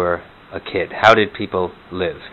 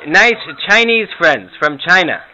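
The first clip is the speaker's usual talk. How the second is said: put-on high, loud, confused old grandma; 11 semitones higher, 4 dB louder than usual. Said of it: "some Morty and Herta imitations".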